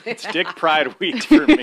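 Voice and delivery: proud. Acted. Proud declaration, building.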